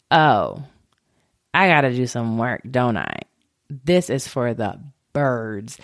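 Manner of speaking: low, resigned grumble; reluctant acceptance. Retold or imitated. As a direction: retell a deflated realization, pitched well down. Retold giving in to therapist.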